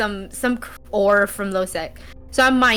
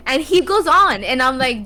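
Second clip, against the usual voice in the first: ordinary delivery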